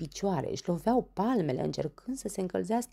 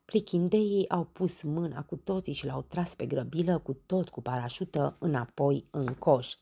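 plain reading voice

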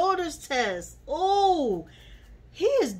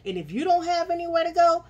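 heated fast rant; 7 semitones higher, 5 dB louder, faster than usual. Real, not acted. Ranting at cast member.

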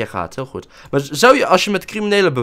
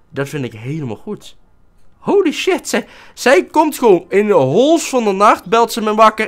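ordinary delivery

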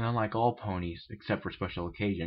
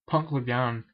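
ordinary delivery, nothing marked

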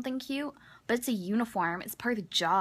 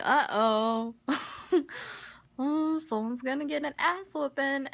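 gleeful teasing; 6 semitones higher, 4 dB louder than usual. Retold or imitated. Gleeful about coming trouble.

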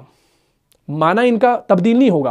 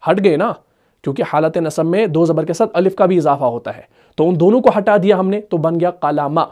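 normal talking voice

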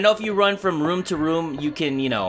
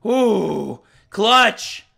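normal talking voice

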